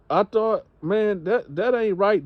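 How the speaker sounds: high, put-out grumble; indignant man voice. Acted. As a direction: play him wounded and high-pitched. Comedian voicing the homeless man.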